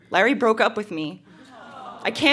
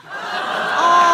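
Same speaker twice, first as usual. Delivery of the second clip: cooing aw; 6 semitones higher, 4 dB louder than usual. Acted. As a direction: swoon over the sweet line. Cooing over sweet diary entry.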